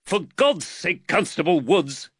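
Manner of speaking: high-pitched, exasperated rebuke; irritated snap. A narrator's reading. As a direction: snap at the constable. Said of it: "Reed snapped".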